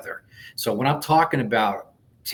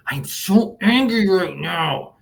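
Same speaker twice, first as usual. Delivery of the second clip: higher and louder, mock angry; demo angry line; 6 semitones higher, 4 dB louder than usual. Shown as a demonstration. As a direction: voice the anger as an example. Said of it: "the S is say what this emotion is".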